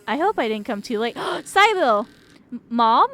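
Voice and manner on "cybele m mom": gasping high loud cry of a name; startled relieved shout; surprised gasp. Real, not acted. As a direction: gasp then call out the name. Gasp, calls out to mother.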